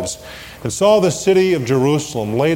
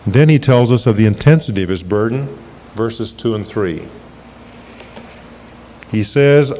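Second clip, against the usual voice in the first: ordinary talk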